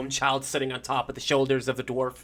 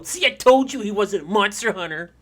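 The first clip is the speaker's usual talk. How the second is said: put-on loud, raised-pitch kid voice; gloating child; 6 semitones higher, 10 dB louder than usual. Daniel tells the others he was right, loud gloating. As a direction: crow I-told-you-so like a child. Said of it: "you hear the kid go".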